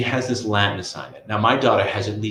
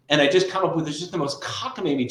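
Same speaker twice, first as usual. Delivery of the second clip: ordinary talk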